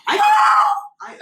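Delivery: sudden very high, loud shout; demonstration yell. Shown as a demonstration. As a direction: yell it out as if in someone's face. Demonstrates being yelled at in face.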